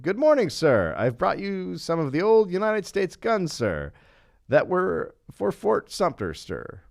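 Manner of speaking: high, loud, hailing announcement; yelled out. Retold or imitated. Robert hailing the Union ship, imitated yell. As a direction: proudly call it out, dropping on each "sir". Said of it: "Robert yelled out".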